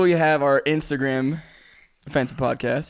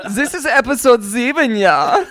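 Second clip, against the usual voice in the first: hammed-up mock-German host, higher; lilting German accent with a tag "ja"; camp German accent; 5 semitones higher, 5 dB louder than usual.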